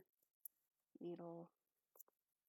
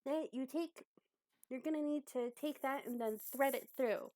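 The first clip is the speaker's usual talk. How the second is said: higher instructor voice, step by step; walk the pupil through it; helpful teacher character; 6 semitones higher, 3 dB louder than usual. Acted. Abby tells Croak how to thread it, helpful.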